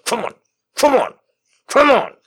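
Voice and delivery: high, strained grunted urging; mimicked exertion, high and squeezed; straining effort. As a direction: imitate someone heaving with each push. Imitates man pounding with his fist.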